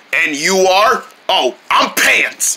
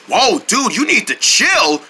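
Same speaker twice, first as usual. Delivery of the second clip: normal talking voice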